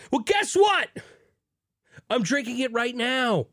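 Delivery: high, thrilled shouting; fired-up shouting about the beer; excited shout. Acted. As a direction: cheer it out like big news. Excited cheering about juice bomb.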